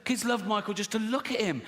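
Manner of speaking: ordinary talk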